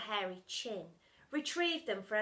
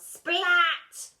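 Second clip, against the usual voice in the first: indignant snap; 9 semitones higher, 14 dB louder than usual. Acted. Splat corrects her name.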